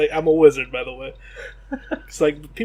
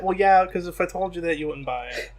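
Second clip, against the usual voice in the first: ordinary delivery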